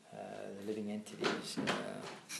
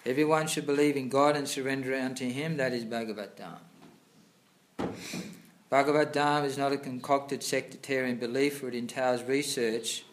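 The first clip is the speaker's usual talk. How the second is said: loud, formal reading of scripture; reading aloud; 3 semitones higher, 7 dB louder than usual. Acted. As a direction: read the passage out like a sermon. Reads purport aloud louder.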